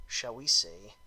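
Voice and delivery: ordinary talk, nothing marked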